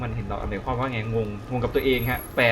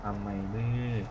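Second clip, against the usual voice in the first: softer, to himself; muttered to himself; 6 dB quieter than usual. Real, not acted. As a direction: murmur it under your breath. Repeats question to himself. (พึมพำกับตัวเองเบาๆ)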